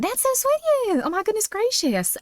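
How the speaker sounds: put-on Southern belle accent. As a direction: coo it like a sweet Southern lady. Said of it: "You really nailed the accent".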